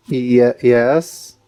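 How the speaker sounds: usual speaking voice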